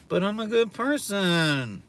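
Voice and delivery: high, slow, whiny insistence on being good; mocking self-righteous girl. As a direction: drag it out like a smug girl. Repeats mocked girl's line.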